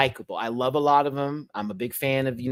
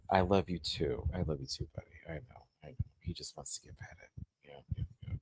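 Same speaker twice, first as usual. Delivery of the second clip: tender murmur; 6 semitones lower, 12 dB quieter than usual. Real, not acted. Soothing baby quietly.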